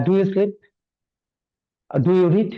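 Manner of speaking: example questions. Shown as a demonstration. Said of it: "যখন do দিয়ে বাক্য বলবেন".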